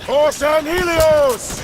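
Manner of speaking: battle cry. Acted. Arbiter leading the charge, shouting a battle cry. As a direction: roar it as you charge.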